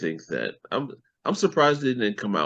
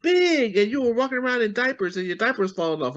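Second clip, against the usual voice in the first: very high put-on relative voice; gushing relative; 3 dB louder, faster than usual. Relative embarrassing kid at family reunion, high mock gushing. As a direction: mimic a doting relative, pitched way up.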